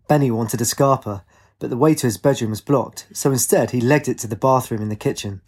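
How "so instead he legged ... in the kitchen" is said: usual speaking voice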